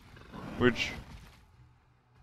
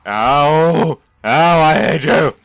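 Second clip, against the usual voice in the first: loud, raised-pitch outburst of pain; pained angry yell; 6 semitones higher, 12 dB louder, slower than usual. Real, not acted. Gamer attacked by a stupid rat thingy, pained angry yell. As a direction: yelp and yell at what hurt you.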